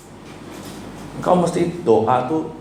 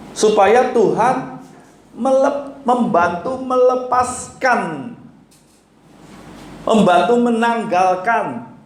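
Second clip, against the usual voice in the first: preaching at full force; 8 semitones higher than usual. Acted. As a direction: drive it home to the congregation. (khotbah berapi-api dengan suara tinggi)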